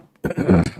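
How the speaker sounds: loud rough throat clear. Real, not acted. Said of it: "excuse me again".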